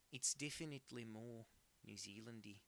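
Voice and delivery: put-on Kiwi accent; New Zealand accent. Shown as a demonstration. Streamer conceding to chat, in a put-on New Zealand accent. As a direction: repeat it as a New Zealander would.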